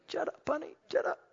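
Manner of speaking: a tender but firm hush; sweet hush. Retold or imitated. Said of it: "tells it so sweetly".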